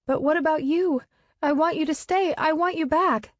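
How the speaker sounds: put-on high, begging like a small child; small child's tearful begging; childlike pleading. A narrator's reading. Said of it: "I plead like a small child begging".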